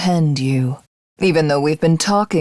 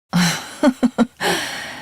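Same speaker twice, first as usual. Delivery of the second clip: soft amused laugh; 5 semitones higher, 3 dB quieter than usual. Acted. Amused at the captive.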